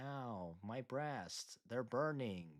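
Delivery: very slow, soft, low lament; quiet, half-hearted agony; mock victim. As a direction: drawl a deadpan cry of pain.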